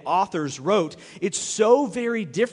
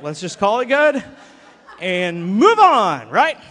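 sarcastic wrap-up; 8 dB louder than usual. Pretend. Mock dismissal, sarcastic.

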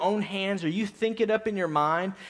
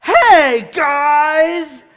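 higher, much louder outraged call; indignant shout; 4 semitones higher, 11 dB louder than usual. Retold or imitated. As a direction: call out the whole family in protest. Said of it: "and she went".

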